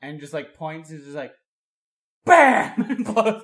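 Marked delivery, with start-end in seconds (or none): 2.2-2.8 shouted bam sound effect; mock gunshot. Buddy acting out a rocket shot, booming bam. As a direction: yell the rocket blast, much louder and higher. Rocket fired in retold scene.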